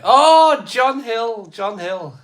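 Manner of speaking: big, high, loud "oh" of recognition; light up at a familiar name; excited greeting to a chat regular; delighted surprise. Real, not acted. Spots a viewer in chat.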